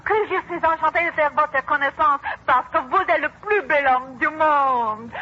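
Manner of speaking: a French delegate's gushing welcome; French greeting. Acted. Teacher voicing a French delegate's welcome. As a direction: greet him in fluent, flowery French. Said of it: "and he will say".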